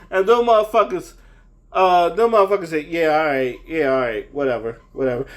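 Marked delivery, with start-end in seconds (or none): 2.8-5.2 lower, bored brush-off, getting lower; a lightly voiced, unbothered person waving the cops off; mock dismissive.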